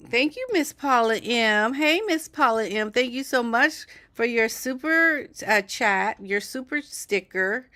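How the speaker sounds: excited thanks. Real, not acted. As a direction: beam at her for the gift. Thanks viewer for super sticker.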